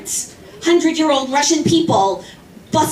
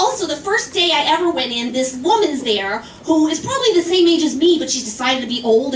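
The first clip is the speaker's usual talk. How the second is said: ordinary talk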